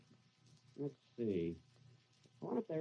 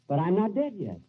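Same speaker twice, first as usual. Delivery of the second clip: higher and much louder indignant protest; alarmed protest; 8 semitones higher, 9 dB louder than usual. Acted. Benny protesting to his ghost that he still lives, alarmed. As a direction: panic as you object.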